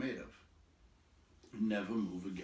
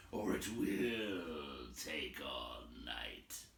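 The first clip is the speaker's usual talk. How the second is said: lower, slow, softer troll; 8 semitones lower, 6 dB quieter, much slower than usual. A narrator's reading. Troll warning it will take all night, low and slow. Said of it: "he said".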